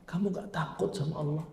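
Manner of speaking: softly, pleading woman's rebuke; woman quietly asking if he fears Allah; quiet plea. Retold or imitated. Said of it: "Apa kata laki-, perempuan ini".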